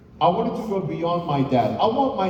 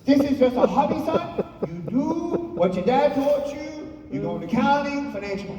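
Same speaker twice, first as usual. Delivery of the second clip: high-pitched mother laying down the law; imitation of a mom giving orders; bossy mom; 9 semitones higher, faster than usual. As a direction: lecture the son in a mock mom voice. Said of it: "She said".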